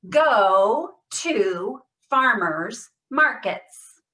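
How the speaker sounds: slow, loud, every sound exaggerated; over-exaggerated stress. Shown as a demonstration. Therapist leading a Parkinson's voice drill, exaggerated stress. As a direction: stretch and punch each word. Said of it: "Every sound, over exaggerate".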